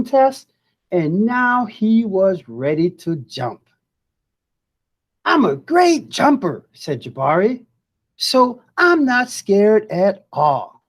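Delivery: lively storybook voice with big rises; reading to children. Acted. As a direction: read it like a picture book to kids. Teacher reads picture book aloud.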